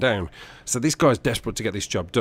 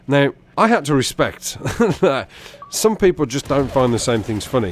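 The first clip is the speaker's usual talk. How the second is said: ordinary delivery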